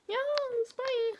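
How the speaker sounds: high, bright goodbye to the viewers; cheery sign-off. Real, not acted. Vlogger signing off to her viewers, high cheery bye. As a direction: sing out the bye like a farewell wave.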